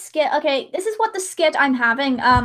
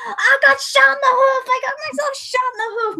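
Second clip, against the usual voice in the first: much higher, complaining yelp, fast at the end; wounded pony; 11 semitones higher than usual. Acted. Pony shot in the skit, wailing in a much higher voice. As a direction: wail it like a cartoon casualty. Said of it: "and it's like".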